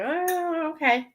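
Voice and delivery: high drawn-out "oh", then okay; doubtful acceptance. Retold or imitated. Acts own doubtful reaction.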